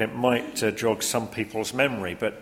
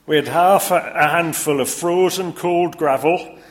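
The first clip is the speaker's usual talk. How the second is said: put-on Yorkshire accent; 5 semitones higher, 7 dB louder than usual. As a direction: pile it on in broad Yorkshire. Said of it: "Yorkshire accent in the different, uh, character voices".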